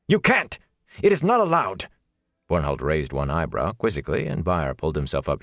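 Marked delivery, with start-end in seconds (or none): 0.1-1.9 high, loud, outraged protest; outraged snap. A narrator's reading. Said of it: "an outraged snap to his words".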